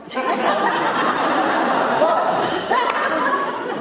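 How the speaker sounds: high, unvoiced laugh bursting out; a real breathy laugh after the punchline; burst of laughter. Real, not acted. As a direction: laugh out loud at your own joke. Laughs at own joke.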